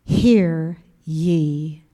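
low, very slow, solemn divine voice; voice of God. Retold or imitated. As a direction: speak as God to a daughter, low and weighty. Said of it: "And he said".

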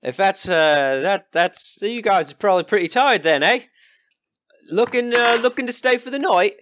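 high, brash country villager; rough villager. Acted. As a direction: greet travellers as a nosy local. GM voices a villager.

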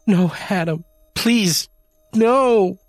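high, rising panicked plea; desperate begging. A narrator's reading. Wife begs husband not to hurt kitten, high panicked pleading. Janie begs Adam to stop.

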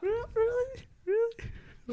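high squeaky disbelief, drawn out; incredulous squeal. Real, not acted. Hearing a favorite character was cut, high incredulous squeal. Reacting to Johnny Cage being cut.

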